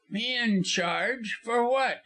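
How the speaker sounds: high, drawn-out disbelief; startled, high-pitched protest; indignant astonishment. A narrator's reading. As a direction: stretch the first question, then pitch up. Lawyer astonished at threat.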